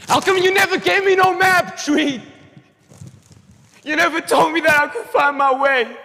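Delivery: high, loud furious yell; shouting. Acted. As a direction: scream the accusation at him. Character confronts Treat, shouting.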